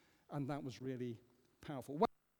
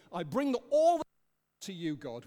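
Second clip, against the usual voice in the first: raised voice, a bold offering of everything; loud mock prayer; 10 dB louder than usual. Preacher voicing the plain prayer the psalmist could have said, loud. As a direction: call it out like a grand sweeping offering. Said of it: "why doesn't he just say".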